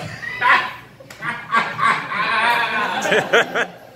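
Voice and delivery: big loud whole-body burst of laughter. Shown as a demonstration. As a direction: throw your whole body into a roaring laugh.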